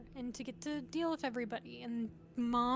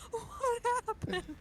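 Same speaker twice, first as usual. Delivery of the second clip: bewildered cry; 10 semitones higher, slower than usual. Retold or imitated. Dad's bewildered cry in story.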